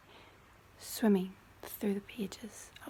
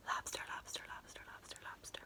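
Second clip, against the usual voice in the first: each angelfish softer than the last; fading whispered echo; 9 dB quieter, faster than usual. Pretend. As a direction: breathe the word away three times.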